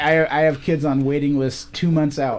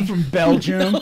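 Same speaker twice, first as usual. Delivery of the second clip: louder mock Belgian accent through a laugh; 4 semitones higher, 5 dB louder than usual. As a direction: play a Belgian in a jokey accent. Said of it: "Where are you from".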